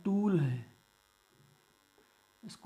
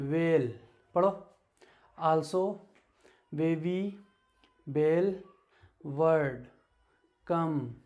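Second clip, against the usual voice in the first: louder, each word called out clearly; read-along drill; 4 dB louder, much slower than usual. Acted. Teacher leads the class reading word list aloud. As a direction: call out each word for students to repeat.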